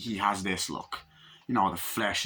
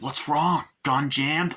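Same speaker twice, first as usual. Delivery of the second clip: raised, louder mocking quote; villain's taunt; 4 semitones higher, 4 dB louder, slower than usual. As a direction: taunt the victim, pitched up. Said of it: "and he's like".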